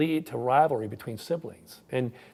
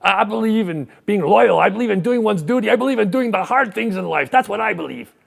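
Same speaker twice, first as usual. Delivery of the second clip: high, loud proclaiming politician; Jean Chrétien; 8 semitones higher, 10 dB louder than usual. Acted. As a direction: declare each belief up high and loud. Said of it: "And Jean Chrétien responds".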